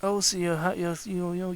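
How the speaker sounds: ordinary talk, nothing marked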